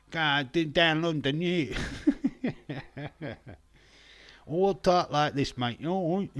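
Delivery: high, exaggerated London accent; mock London accent. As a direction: ham up a London Cockney. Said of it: "what all London people sound like".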